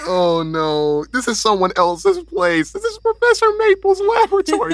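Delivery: high, strained character voice. Acted. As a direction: squeak it out in a high voice. Player voicing character, high pitch.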